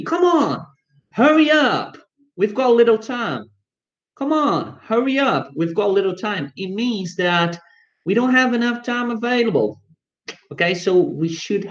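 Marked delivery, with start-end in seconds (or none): 0.0-6.5 high, urgent, rushing someone; urgent hurry. Retold or imitated. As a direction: hurry them along, pressed for time. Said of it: "if you say to me".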